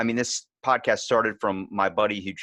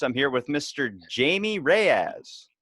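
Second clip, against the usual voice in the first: usual speaking voice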